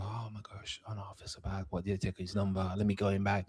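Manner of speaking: soft whispering, mocking sympathy; whispered mock pity. Mocking an over-apologetic caller.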